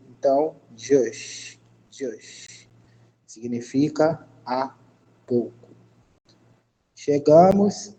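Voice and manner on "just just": "just" opening on a DJ sound; sound demo. Shown as a demonstration. Teacher spells out English sounds for students, "just" with a DJ onset. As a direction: say "just" with J as D plus J. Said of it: "o J tem um som de D e J".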